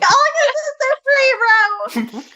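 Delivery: "superhero" in a very high, slow, teary squeal; squealing excited voice. Real, not acted. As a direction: squeal it like an overwhelmed fan about to cry. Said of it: "What is that voice?".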